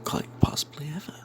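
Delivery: plain reading voice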